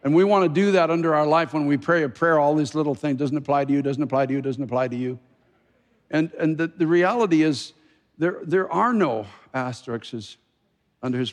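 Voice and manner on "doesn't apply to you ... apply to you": mock advert small print, rattled off; rapid disclaimer voice. Preacher mimicking advert exceptions under a prayer, rapid disclaimer voice. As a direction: race through it on repeat like legal exceptions. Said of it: "voice that goes a hundred miles an hour".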